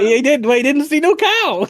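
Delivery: put-on high, laughing punch-drunk boxer. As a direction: keep the dazed boxer going. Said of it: "drunk boxer".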